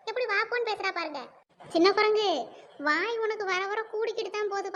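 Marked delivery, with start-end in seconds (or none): 1.7-2.3 loud, scolding insult; angry name-calling. Acted. Elder sister scolding the younger for disrespect, loud and cross. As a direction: hurl the insult at her loudly.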